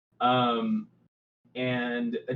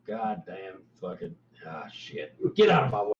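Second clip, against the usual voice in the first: muttered curses, then a shove of an order; grumbling bugbear; 4 semitones lower than usual. Acted. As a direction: grumble low, then bark it out. Bugbear NPC cursing.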